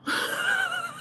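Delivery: very high squeal of laughter; thin, high wheezing giggle; laughing. Real, not acted. Storyteller tickled by the skit, high squealing laugh.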